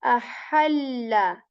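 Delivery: ahalla drawn out slowly with a breathy middle ha; ahalla with airy ha. Shown as a demonstration. Teacher letting students hear the difference. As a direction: slowly push air on the ha of ahalla. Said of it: "But here we say".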